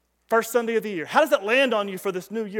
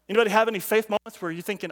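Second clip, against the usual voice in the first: ordinary talk, nothing marked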